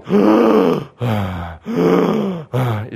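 loud, drawn-out mimicked gasps; re-enacted gasping for air; imitated labored breathing. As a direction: imitate someone struggling for each breath. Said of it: "she barely could breathe".

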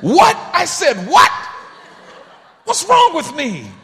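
high, loud, laughing indignation; over-the-top shouted disbelief; mock self-outrage. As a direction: ham up being furious at yourself.

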